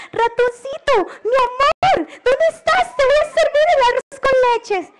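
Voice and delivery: high, singsong cockroach wife voice; doting storybook wife searching the house; calling for husband. Acted. Cockroach calls for her missing husband.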